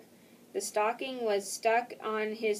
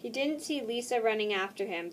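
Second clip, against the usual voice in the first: usual speaking voice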